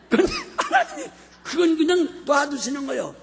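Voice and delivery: laughing. Real, not acted. Joking about pastor's hair.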